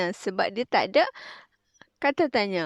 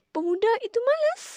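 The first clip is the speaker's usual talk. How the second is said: "Pemuda itu malas." said much higher, the voice rising on "malas"; rising question tune; 13 semitones higher, 5 dB louder than usual. Shown as a demonstration. Teacher demonstrating to the class a high questioning "malas". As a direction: ask it, sweeping "malas" up high. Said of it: "Kalau kita letak titik ... kita kena tinggikan sedikit".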